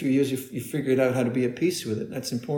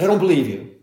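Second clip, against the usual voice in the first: louder, imitated New Zealand interviewer's accent; a hostile interviewer with a New Zealand accent, doubting; Kiwi accent; 4 semitones higher, 6 dB louder, faster than usual. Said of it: "In a kind of Kiwi accent".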